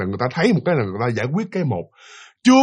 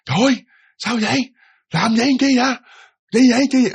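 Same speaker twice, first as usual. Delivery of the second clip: high-pitched fussing; 9 semitones higher, faster than usual. Retold or imitated. Father fretting at his wife in a much higher voice. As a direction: voice pushed much higher, fretful questions. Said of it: "tông giọng nó cao lên á".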